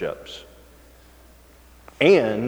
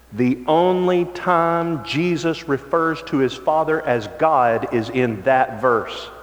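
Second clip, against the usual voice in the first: normal talking voice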